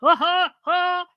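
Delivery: deliberately corny fake laughter. Shown as a demonstration. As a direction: push out a hammed-up fake laugh. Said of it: "we'd start off fake laughing".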